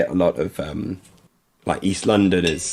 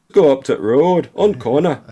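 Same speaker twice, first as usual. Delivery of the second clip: loud, higher-pitched mock Barnsley accent; thick Yorkshire accent; 6 semitones higher, 6 dB louder than usual. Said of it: "that was a thick accent there".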